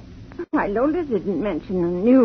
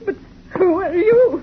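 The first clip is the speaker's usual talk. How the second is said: tearful; 6 semitones higher, 4 dB louder than usual. Acted. Tearful woman confronts stranger.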